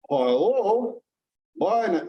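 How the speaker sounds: put-on vague foreign accent, pitched a little up; non-specific country accent. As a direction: put on a generic foreign scam-caller accent. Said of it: "a non-specific country accent".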